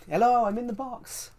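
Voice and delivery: high-pitched muffled-prank call; imitated man in box. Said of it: "him knocking on a piece of plank".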